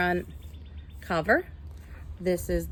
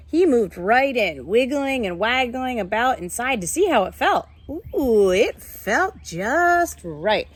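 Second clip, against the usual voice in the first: lively, higher storybook read-aloud; reading to children; 4 semitones higher, 4 dB louder, slower than usual. A narrator's reading. Teacher reading a picture book to her class, lively storytelling.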